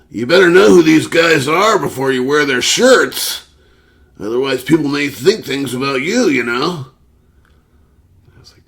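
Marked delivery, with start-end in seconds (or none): 0.1-6.9 lecturing coworker. Retold or imitated. Coworker lecturing the narrator about band shirts, high mock. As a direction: wag a finger while you say it.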